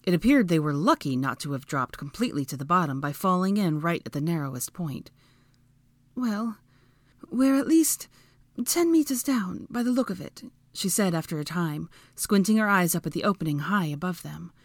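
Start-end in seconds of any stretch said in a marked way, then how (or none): 6.1-10.4 higher, measured, thoughtful pauses; female character voice. A narrator's reading. Hermione squinting up at the opening, in high voice. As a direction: pitch it up and assess carefully.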